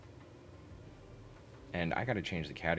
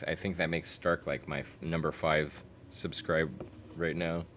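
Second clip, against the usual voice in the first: ordinary delivery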